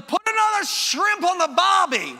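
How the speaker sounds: loud, high, hammed-up Australian shout; Australian accent. Shown as a demonstration. Preacher showing English dialects to his congregation, hammed-up Australian accent. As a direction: holler it in a broad mock-Australian accent. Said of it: "Or the Australian".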